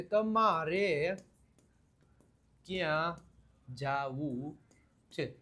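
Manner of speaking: each word spaced out slowly and softly. Shown as a demonstration. Teacher spelling out the question for students, slow and word by word. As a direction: pause between every word so students follow.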